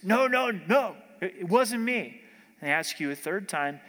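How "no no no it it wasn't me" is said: louder, higher, acted-out protest; emphatic denial. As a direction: insist hard that it was someone else. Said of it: "He got more emphatic".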